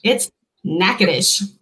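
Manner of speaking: Natchitoches said slowly as NACK-uh-dish; slow teaching demo of Natchitoches, ending in "dish"; Louisiana pronunciation. Shown as a demonstration. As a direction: show the local way, Natchitoches as three syllables, NACK-uh-dish. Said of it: "but in Louisiana, it's Natchitoches".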